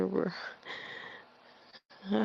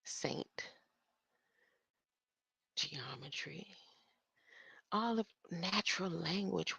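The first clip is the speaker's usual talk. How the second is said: whisper; 14 dB quieter than usual. Real, not acted. Muttering while reading alone.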